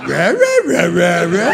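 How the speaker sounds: loud laughing Scooby-Doo rah rah. Retold or imitated. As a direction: bark the words like a cartoon dog. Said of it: "gonna sound like Scooby-Doo".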